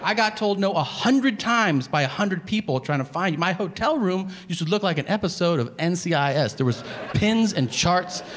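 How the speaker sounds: indignant, worked-up tirade; exasperated rant. Retold or imitated. Diner lectures unimpressed waiter on the wallet search, exasperated. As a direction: pile up the proof in growing disbelief.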